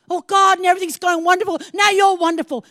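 high, loud, fast gushing; gushing thanks. Retold or imitated. Believer praising God when all goes well, loud and gushing. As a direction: rush out happy praise. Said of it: "Isn't it like that".

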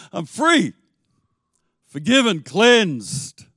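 joyful release. Retold or imitated. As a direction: let it burst out, free at last. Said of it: "but it was like".

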